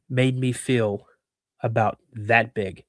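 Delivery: plain reading voice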